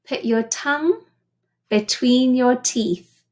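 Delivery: ordinary talk, nothing marked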